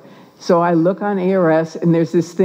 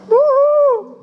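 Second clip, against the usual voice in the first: high-pitched retold "woo"; celebratory whoop; 3 dB louder than usual. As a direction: let out a high, drawn whoop. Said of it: "And I'm like".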